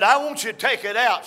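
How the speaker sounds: retold demand. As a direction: insist loudly, mock-firm.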